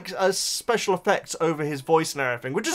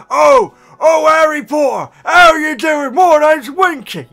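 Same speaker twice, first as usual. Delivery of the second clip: loud, shouted elf impression; hammed-up audiobook elf, high and blaring; Jim Dale's Dobby-style Winky; 11 semitones higher, 13 dB louder, slower than usual. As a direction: belt out a mock house-elf voice. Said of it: "he just does his Dobby voice again".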